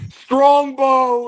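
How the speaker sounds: high, very loud bellow; booming name call. Retold or imitated. Teller imitates Chief calling out "Strongbow", booming high bellow. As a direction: bellow the name out in one big burst.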